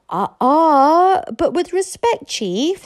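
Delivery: high, loud polite objection; eager young friend. Acted. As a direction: interrupt the chief deferentially.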